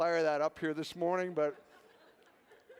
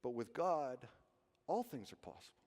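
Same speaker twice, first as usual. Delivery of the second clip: soft, low inner voice; inside voice; 7 semitones lower, 7 dB quieter than usual. Shown as a demonstration. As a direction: say it gently inside your head. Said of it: "inside voice".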